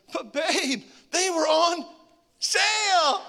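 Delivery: husband whining a guilty excuse to his wife, high and loud; sheepish whine. Retold or imitated. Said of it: "said something I truly regret".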